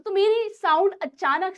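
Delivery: teacher raises her voice for the class; demo loud voice. Shown as a demonstration. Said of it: "अगर अभी मैं चिल्लाना शुरू कर दूँ".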